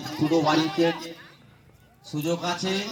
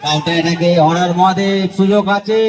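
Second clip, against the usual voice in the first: excited; 7 dB louder than usual. Real, not acted. Counter attack chance.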